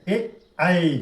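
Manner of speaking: short ए, long gliding ऐ; correct vowel contrast. Shown as a demonstration. Teacher shows the correct pair, short ए and long ऐ. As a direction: keep ए brief, then stretch ऐ out. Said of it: "चार second".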